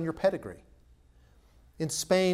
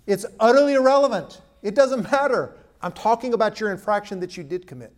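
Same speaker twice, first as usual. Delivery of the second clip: much higher and louder, exasperated; exasperated rebuke; 7 semitones higher, 9 dB louder than usual. Retold or imitated. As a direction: cut the excuse down hard. Judge rebuking the excuse.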